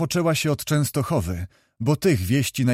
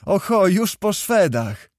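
offhand call; 6 semitones higher, 3 dB louder, slower than usual. A narrator's reading. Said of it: "zawołała z niechcenia".

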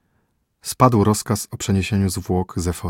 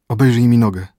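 a pained groaning cry, louder; moaning plea; 3 semitones higher, 8 dB louder than usual. A narrator's reading. Poisoned prince moans to the physician, pained groan. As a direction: groan the words out in pain. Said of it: "jęknął Horus".

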